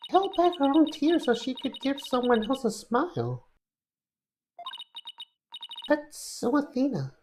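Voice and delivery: put-on high, slow girlish voice; high-pitched, touched girl's voice; admiring daughter character. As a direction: speak high and drawn out, moved by a friend. Daughter character admires her friend.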